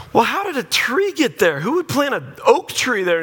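high-pitched incredulous questions; hammed-up indignant disbelief; mock bafflement. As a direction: pitch leaping up in exaggerated puzzlement. Baffled homeowner retold.

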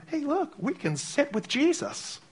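put-on a bright childish caricature; mock naive voice. Preacher parodying a shallow idea, high mock sweetness.

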